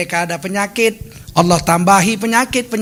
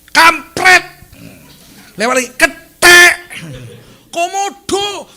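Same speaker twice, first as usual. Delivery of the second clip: high furious yells at the other driver; road rage cursing; 12 semitones higher, 10 dB louder, slower than usual. Retold or imitated. Preacher acting out a driver overtaken on the right, furious shouting. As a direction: shriek insults out the car window like a raging driver.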